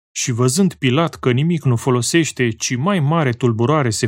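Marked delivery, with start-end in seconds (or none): none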